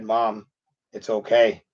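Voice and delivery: soft reassurance. Retold or imitated. Comforting his tearful mom.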